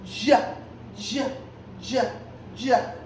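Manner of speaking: short, separate "J" sounds, each said as "juh"; the J sound on its own, repeated for a class; letter J sound. Shown as a demonstration. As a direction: sound out J as a quick "juh", pausing between. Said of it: "how does the letter J sound go".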